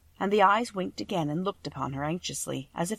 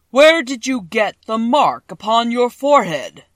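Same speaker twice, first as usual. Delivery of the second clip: slow, booming, much louder giant head; a vast, slow, thundering wizard; voice of the great Oz; 4 semitones higher, 13 dB louder than usual. A narrator's reading. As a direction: boom each word out like a huge magic head.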